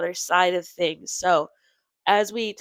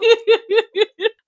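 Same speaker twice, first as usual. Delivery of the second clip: high, loud laughter; 10 dB louder than usual. Real, not acted. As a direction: crack up loudly. Laughing at petroleum jelly.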